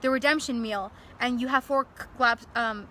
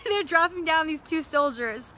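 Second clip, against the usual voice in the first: laughing; 4 semitones higher, 3 dB louder than usual. Real, not acted. Laughing while retelling.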